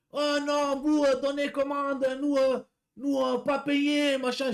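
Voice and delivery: high, very slow broken French with a put-on foreign accent; mock foreign accent. Driver mimics the customer refusing to pay, slow mock foreign accent. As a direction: drag out her protest in a foreign accent. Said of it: "je vous fais l'accent".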